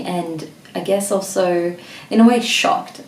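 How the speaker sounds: normal talking voice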